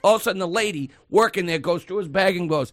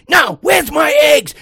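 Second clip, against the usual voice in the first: high, slow imitated shout, much louder; losing it; 11 semitones higher, 9 dB louder, slower than usual. Older customer shouting down the cashier, losing it. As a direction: bellow it like an outraged customer. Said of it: "he loses it on this girl".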